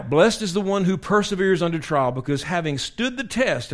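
full-force preaching of the verse. Acted. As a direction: proclaim it to the congregation.

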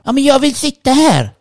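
high-pitched mock screech; imitated shrieking woman. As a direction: mimic an indignant woman shrieking to get a seat. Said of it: "hon såg och skrek på mig".

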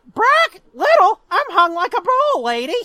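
high, loud parrot squawk; indignant movie bird squawking its comeback. Retold or imitated. Parrot from the movie answering an insult, loud squawk. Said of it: "he's like".

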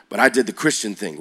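ordinary talk, nothing marked

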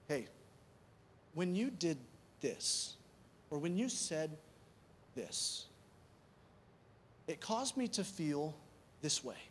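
soft, careful and measured, with pauses; humble. Acted. Speaker approaching someone about a hurt, softly and humbly. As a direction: broach it gently, voice dropping at phrase ends. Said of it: "when you come with that kind of humility".

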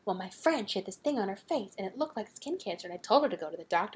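soft, low-key droning anecdote; quietly rambling on as the mom; rambling mom story. Retold or imitated. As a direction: imitate a mom murmuring through a pointless story. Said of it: "doing one of those rambling stories".

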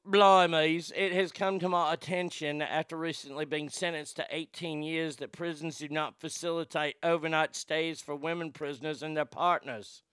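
mock British inmate reading a quote; British-accented formal complaint, lightly mocking. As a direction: put on a hammed-up British accent. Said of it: "then she has a British accent".

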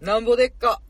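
loud, punchy Kansai merchant phrase; calling out an answer. Pretend. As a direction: fire the Kansai line out loudly. Calls out Kansai quiz answer. (威勢よく大声の関西弁で)

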